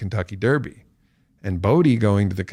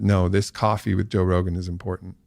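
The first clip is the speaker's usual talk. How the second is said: usual speaking voice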